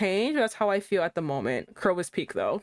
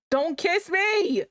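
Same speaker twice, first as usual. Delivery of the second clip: protesting cry; 9 semitones higher, much slower than usual. Acted. Reading heroine's protest.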